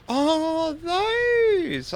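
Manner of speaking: sudden delighted discovery; surprised realization. Real, not acted. Suddenly spots the blue circles.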